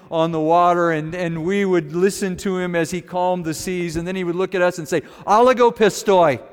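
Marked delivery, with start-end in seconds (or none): 5.3-6.4 loud, higher-pitched mock scolding; exasperated rebuke. Jesus chiding his disciples for little faith, exasperated rebuke. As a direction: call them out in acted exasperation.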